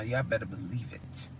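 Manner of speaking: low, soft promise; hushed aside. Real, not acted. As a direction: drop low and soft, confiding. Says it low, then whispers it.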